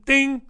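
high, short "ding"; imitated bell ding. Host ticking off a list item, high ding. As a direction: chime it like a checklist bell.

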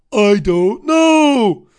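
mocking the caller, loud and very slow; mock clueless caller. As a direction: drag it out, baffled and empty. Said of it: "says the person".

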